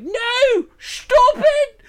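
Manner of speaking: very high, loud mock yell; imitating Aaron's yell. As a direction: shriek it like Aaron panicking. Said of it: "Aaron's like".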